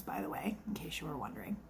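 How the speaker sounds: sly whispered aside to the camera. Real, not acted. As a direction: drop to a whisper like sharing a secret. Conspiratorial aside to the viewers.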